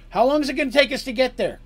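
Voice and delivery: high shouted question; yelled question. Acted. Captain asking his navigator for the travel time, yelling. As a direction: call it across the ship. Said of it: "yells out of the ship".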